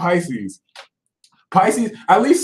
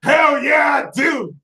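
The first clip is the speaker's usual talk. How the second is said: high, loud, proud shout; defiant high shout; defiant yell; 9 semitones higher, 3 dB louder than usual. Pretend. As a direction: throw it back in their faces.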